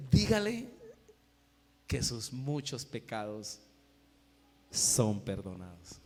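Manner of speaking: solemn, slow pronouncement dropping low; voice of the Lord. Acted. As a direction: command first, then let each phrase sink with long pauses. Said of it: "el Señor le dice".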